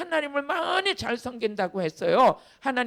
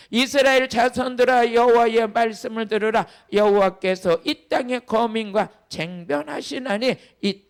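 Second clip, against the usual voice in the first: loud scripture reading; 7 dB louder than usual. Acted. Pastor reading Hosea aloud with the congregation, loud and solemn. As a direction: proclaim the verse to the whole hall.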